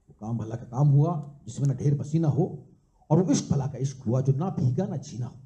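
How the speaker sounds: measured, rhythmic verse with a lilting refrain; live poetry recital; poem recitation. Acted. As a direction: recite it as a couplet, landing on each rhyme.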